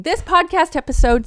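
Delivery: heated outburst mid-rant; getting emotional. Real, not acted. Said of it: "sorry for getting so emotional".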